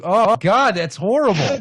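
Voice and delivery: high, loud groaning disgust; amused horror. Real, not acted. Friend appalled by the pictured scene, high horrified outburst. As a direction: wail in comic revulsion.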